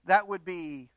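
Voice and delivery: loud, high, driving pulpit voice; full-force preaching. Acted. Pastor warning his congregation against rushing discipline, in full-force preaching. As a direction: preach it hard, building to a climax.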